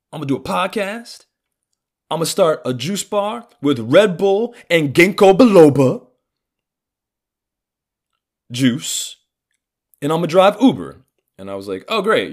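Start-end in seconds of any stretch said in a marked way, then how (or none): 0.1-11.1 high, loud, boastful caricature; imitated father. Dad laying out his plan in life, high mocking caricature. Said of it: "And then he goes". 11.9-12.3 sarcastic enthusiasm. Retold or imitated. Sarcastic reply to dad's plan.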